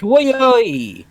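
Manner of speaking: high mock-French Koi; bad French. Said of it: "bad French makes your French look better".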